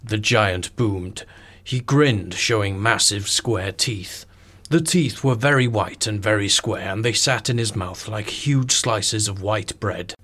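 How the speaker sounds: slow, dramatic storybook reading; story narration. Acted. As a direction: read it slow for a bedtime story. Narration continues.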